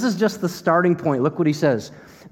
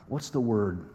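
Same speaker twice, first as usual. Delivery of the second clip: usual speaking voice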